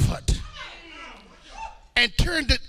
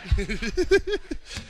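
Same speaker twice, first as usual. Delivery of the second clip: soft, breathy run of chuckles; 4 dB quieter than usual. Real, not acted. Preacher joking about getting something to eat, soft chuckle. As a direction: laugh quietly at your own aside.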